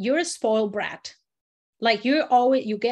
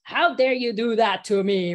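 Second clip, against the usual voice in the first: a hint of a scolding mother; mock mom telling off a child; 4 dB louder than usual. Teacher imitating how students played an angry mom. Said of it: "like a mom".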